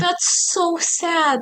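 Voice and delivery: high, slow wail of sympathy; pitying. Real, not acted. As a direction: draw it out high with real pity. Pitying the bereaved mother.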